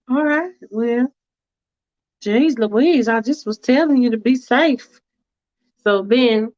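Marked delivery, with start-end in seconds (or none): 0.1-5.0 higher, prim and hesitant; a fussy woman hedging politely; Faith's fussy voice. Acted. Faith hesitating to the driver, prim and higher.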